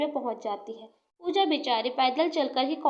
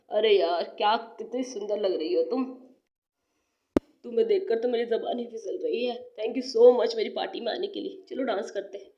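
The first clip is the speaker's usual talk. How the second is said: lower, smooth charmer voice; butter her up in a low voice; low flattering young man; 4 semitones lower than usual. Acted. Gagan flattering Rajni at his party, low and smooth.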